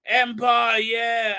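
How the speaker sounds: high, loud, slow gung-ho loyalist; hammed-up Empire loyalist, shouting; super Empire-y guy. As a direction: boom it like a cheerful fanatic. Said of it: "this super Empire-y guy".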